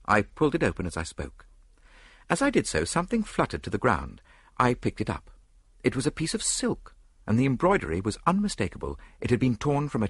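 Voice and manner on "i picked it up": ordinary delivery, nothing marked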